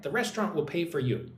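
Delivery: ordinary talk, nothing marked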